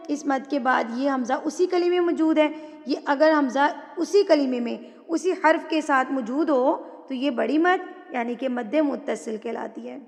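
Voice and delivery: usual speaking voice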